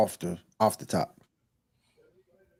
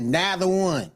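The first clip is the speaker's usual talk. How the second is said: grandma saying; 8 semitones higher, slower than usual. Retold or imitated. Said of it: "as my grandma used to say".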